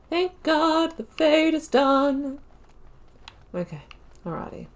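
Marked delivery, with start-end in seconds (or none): 0.1-2.4 high and slow, stretching out "Fade"; drawn-out relief. Shown as a demonstration. Gamer repeating to viewers that the Fade is finally over, high and drawn out. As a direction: sing out the relief, dragging "Fade" long and high.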